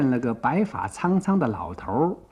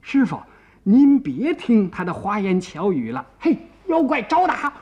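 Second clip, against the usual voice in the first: 高声急劝，结尾猛地拔高; 着急地劝师傅，再尖声喝打; 焦急怒喝; 5 semitones higher, 4 dB louder than usual. A narrator's reading. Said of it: "急得眼都红了". (又急又气地高喊)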